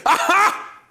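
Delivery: high, loud triumphant skeptic; hammed-up voice of a gloating critic; mock gotcha. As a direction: crow it like you caught them out. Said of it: "And people say".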